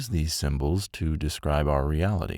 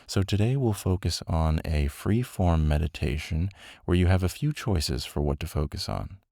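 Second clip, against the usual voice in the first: ordinary talk, nothing marked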